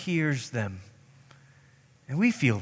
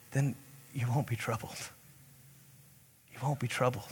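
hushed, low and calming; gentle reassurance; 5 semitones lower, 17 dB quieter, faster than usual. Real, not acted. Preacher promising calm to troubled listeners, softly reassuring. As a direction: let it drop very soft and low. Reassuring close after a raised build-up.

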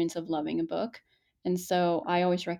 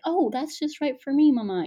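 put-on little boy voice; 8 semitones higher, 5 dB louder than usual. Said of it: "he would say".

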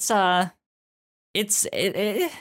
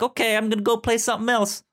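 carefree; 13 semitones higher, faster than usual. Retold or imitated. Retold own inner voice.

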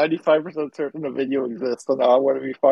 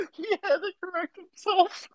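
high-pitched, laughing through the words; helpless laughter. Real, not acted. Co-host catching the host's slip, helpless laughter.

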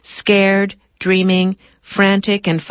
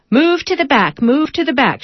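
raised calling voice; 7 semitones higher than usual. A narrator's reading. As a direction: call it down the bus. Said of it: "shouts the patient driver".

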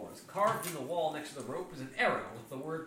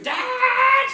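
tiny shrill scream, much higher and louder; high little scream; 16 dB louder than usual. A narrator's reading. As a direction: shriek it like a small child. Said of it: "a little voice scream".

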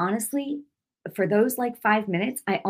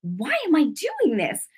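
raised, slowed self-reproach; mildly exasperated with yourself, higher and drawn out; angry at herself; 7 semitones higher than usual. Retold or imitated. Scolding herself for giving in to fear, retold anger. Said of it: "I was angry at myself".

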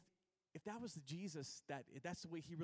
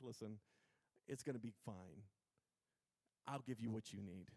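low, soft reassurance; Jesus, comforting; 8 semitones lower, 5 dB quieter than usual. Acted. Jesus promises the burdened they'll be fine, softly. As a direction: soothe quietly, with pauses between phrases.

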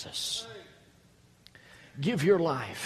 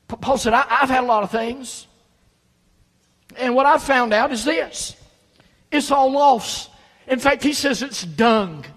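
much higher and louder, fiery pulpit preaching; preaching at full force; 9 semitones higher, 9 dB louder than usual. Acted. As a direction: hammer it home to the congregation. Sermon climax, preaching at full force.

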